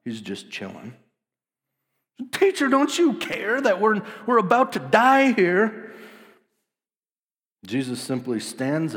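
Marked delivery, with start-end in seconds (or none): 2.3-5.9 high, loud frantic begging; desperate plea. Acted. Disciples begging sleeping Jesus, high frantic cry. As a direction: cry out in mock terror.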